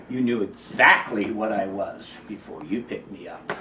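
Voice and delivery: low, quiet, cold snake voice. Acted. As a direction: say it with quiet menace. Snake's final words.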